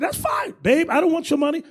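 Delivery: high-pitched sweet-talk to a partner; lightly put-on reassurance to babe; mock reassuring husband. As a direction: wave it away, pitched high. Husband reassuring wife in imagined exchange.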